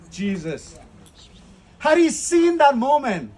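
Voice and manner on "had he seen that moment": rising, louder and higher preacher's climax; preaching at full force. Acted. Preacher urging pilgrims at Gethsemane, building to a loud climax. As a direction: build it up like a revival sermon.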